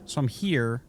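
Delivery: usual speaking voice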